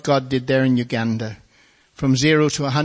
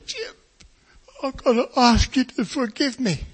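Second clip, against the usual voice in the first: high, tearful plea from a big bearded man; big man in tears; 11 semitones higher, 4 dB louder than usual. Retold or imitated. Big bearded man in tears asking the preacher to forgive him. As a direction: beg forgiveness, choking up. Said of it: "tears all down his beard".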